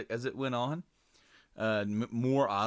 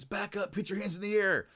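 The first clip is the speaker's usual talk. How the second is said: high, urgent mock police order; cop's command; 10 semitones higher than usual. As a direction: play an armed officer warning a suspect. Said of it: "you know, they're like".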